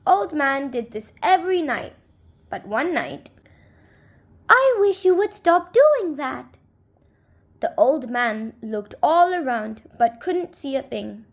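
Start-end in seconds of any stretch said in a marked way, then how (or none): 4.5-6.6 higher, a hint of a small man; peeved little neighbor, lightly voiced; little man's voice. Unseen little man complains to old man, higher pitched.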